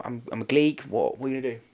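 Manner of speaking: ordinary delivery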